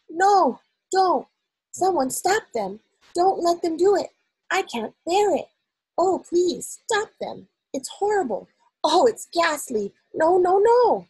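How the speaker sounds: high, pleading nightmare cries; sleep talking. Acted. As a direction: beg in the middle of a bad dream. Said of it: "a slightly blurred sleep talker's voice".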